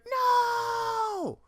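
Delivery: sudden very high anguished shout; high wailing movie-hero no; Luke's cry. Retold or imitated. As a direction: scream the denial.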